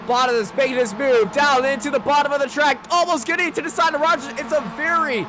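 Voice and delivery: rising, frantic play-by-play shout; excited race call. Real, not acted. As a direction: call the finish at full voice.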